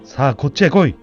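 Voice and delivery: loud furious master's bellow; angry shouting. Acted. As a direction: roar at the servant girl. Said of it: "怒鳴った".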